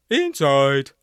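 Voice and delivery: loud barked command; trombone shout. A narrator's reading. Barnstable shoves the prisoners into the dungeon, shouting. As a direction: order them in with a blast. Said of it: "Barnstable shouted".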